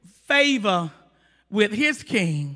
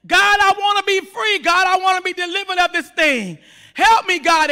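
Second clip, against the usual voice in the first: pleading prayer; 6 semitones higher, 4 dB louder than usual. Acted. Acts out a plea to God.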